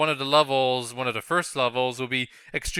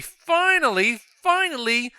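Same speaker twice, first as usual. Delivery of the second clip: high, squeaky, delighted finally; excited squeal; 12 semitones higher, slower than usual. Real, not acted. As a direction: squeal finally with relief at last. Escalating repeated finally.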